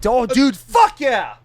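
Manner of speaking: high, loud, stretched-out cheer; excited shout. Real, not acted. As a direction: burst out with real hype. Sudden hype at show's start.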